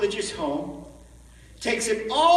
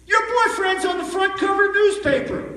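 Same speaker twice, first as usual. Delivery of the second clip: put-on excited girlfriend; 11 semitones higher, 5 dB louder than usual. Girlfriend breaks the news.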